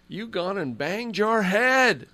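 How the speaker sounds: high, slow, drawn-out mock scolding; Milt Stegall. Host mimics a player in the locker room, high slow impression. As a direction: imitate a player's mild idea of aggressive language. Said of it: "most aggressive language I've heard him say".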